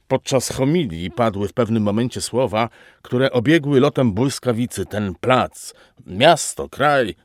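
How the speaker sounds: ordinary delivery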